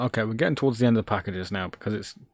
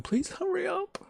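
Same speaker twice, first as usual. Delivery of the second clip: much higher, tiny squeaky plea; 7 dB quieter than usual. Pretend. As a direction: beg in a little squeak. Pleading to the computer.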